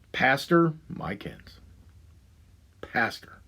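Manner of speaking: slow, breathy whispered name; slow scornful whisper; disgusted whisper. Real, not acted. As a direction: hiss the name in disbelief. Names the pastor with disgust.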